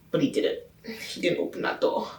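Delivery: breathy hushed letdown; dramatic whisper. Acted. Telling viewers he never opened the door, hushed whisper. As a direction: whisper it like a sad secret.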